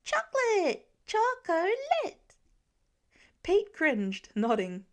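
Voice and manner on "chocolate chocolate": put-on very high, loud child shriek; little boy shrieking a word he just learned. A narrator's reading. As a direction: scream the word with glee. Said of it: "shrieked it again at top volume".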